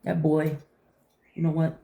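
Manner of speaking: ordinary talk, nothing marked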